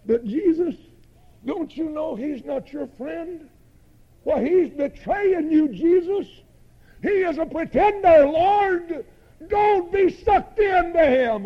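high, loud protest to Jesus; impassioned preaching. Acted. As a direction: cry out a warning like a revivalist. Preacher protests to Jesus at full force.

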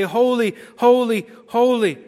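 high, loud proclamation; crying out. Acted. Four living creatures John heard, crying out in worship. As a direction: ring out each word with force. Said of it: "cry out".

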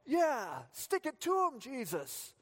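high, excited ringside cheering; hammed-up ringside cheer for Jesus; mock cheering fan. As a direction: egg Jesus on like a boxing fan. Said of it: "we often say".

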